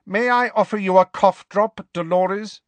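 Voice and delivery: curt, high-pitched offer; terse, irritated schoolmistress. A narrator's reading. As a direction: offer it as a pointed rebuke. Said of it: "Professor McGonagall asked curtly".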